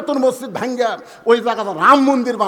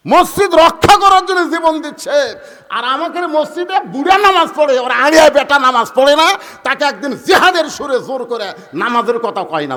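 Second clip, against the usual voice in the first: loud, high-pitched fiery sermon; ringing, raised preacher's voice; full-force preaching; 6 semitones higher, 8 dB louder than usual. Acted. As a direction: preach it out forcefully to a big crowd.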